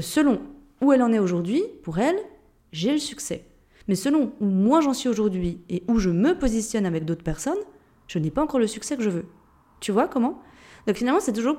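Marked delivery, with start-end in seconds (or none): none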